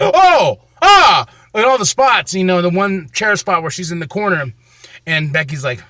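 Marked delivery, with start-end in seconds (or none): 0.0-1.2 high loud thrilled gasp; excited gasp. Retold or imitated. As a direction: react like the match just wowed you. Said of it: "I was like".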